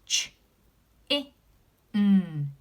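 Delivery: Ch i n said as separate sounds; sounding out. Shown as a demonstration. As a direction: sound out Ch i n one at a time. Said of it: "I can see the ch sound".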